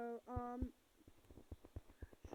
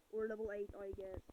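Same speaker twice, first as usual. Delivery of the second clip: ordinary talk, nothing marked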